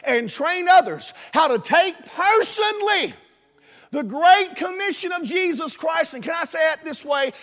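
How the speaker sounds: rousing preacher's voice, peaking high; full-force preaching. Real, not acted. Preacher rallying classes to teach others, full-force preaching. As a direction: climb to a high pulpit cry.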